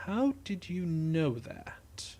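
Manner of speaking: slow, whispery question; suspicious hush. Acted. Criminal narrows his eyes at the engineer, suspicious whisper. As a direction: nearly whisper it, drawing it out.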